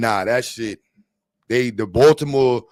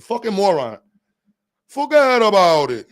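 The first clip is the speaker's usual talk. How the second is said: Italian mobster voice; 5 semitones higher than usual. Acted. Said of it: "Italian mobster voice".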